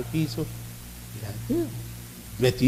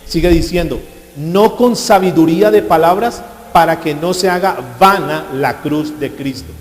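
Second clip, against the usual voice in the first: loud, slow preached verse; preached scripture; 3 semitones higher, 7 dB louder, slower than usual. Acted. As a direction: declaim it slowly, loud.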